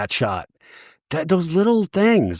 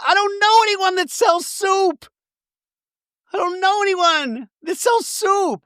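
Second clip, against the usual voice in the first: very high, hammed-up frantic insistence; imitating Angie. Angie insisting to Heather, high mock frantic. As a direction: wail it like a frazzled friend. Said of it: "Angie goes".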